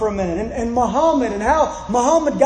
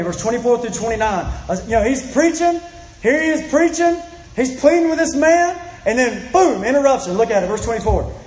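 usual speaking voice

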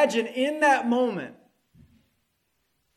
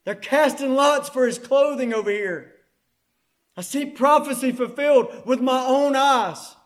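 higher, louder, acted excitement; astonished realisation; 5 semitones higher, 6 dB louder than usual. Preacher as a bystander seeing prophecy fulfilled, excited. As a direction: exclaim it as a witness seeing it happen.

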